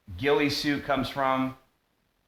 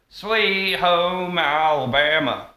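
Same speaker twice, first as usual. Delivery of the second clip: exaggerated Southern accent; 7 semitones higher, 6 dB louder, much slower than usual. Shown as a demonstration. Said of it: "I exaggerated it for effect".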